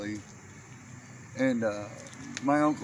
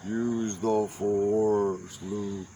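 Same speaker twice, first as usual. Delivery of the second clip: lower, very slow, solemn; movie mentor; 6 semitones lower, much slower than usual. Pretend. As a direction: intone it like a wise Jedi master. Lightsaber cane fight joke.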